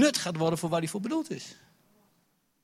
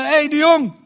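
calling out; 12 semitones higher, 12 dB louder, slower than usual. Retold or imitated. Crowd calling footballer.

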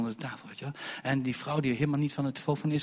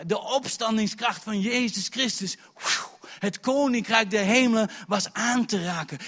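raised, loud revivalist proclamation; full-force preaching; 8 semitones higher, 7 dB louder than usual. Real, not acted. Preacher telling of the graves opening, fervent preaching. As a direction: proclaim it high and strong.

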